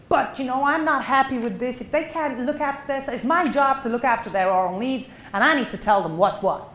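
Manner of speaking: a mock New York hygienist standing her ground; indignant hygienist. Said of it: "She doesn't quite speak like that".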